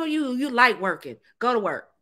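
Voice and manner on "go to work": ordinary delivery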